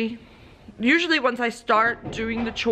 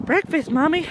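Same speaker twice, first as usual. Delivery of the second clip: higher, playful voiced dog; dog's voice; 7 semitones higher than usual. Owner voicing her dog asking for breakfast, playful. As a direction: speak for the dog asking for food. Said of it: "She's like".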